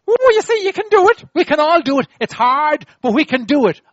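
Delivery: high, loud cheering-on; excited encouragement. Real, not acted. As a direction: whoop it up and rally the crowd. Cheering the congregation on after repeating.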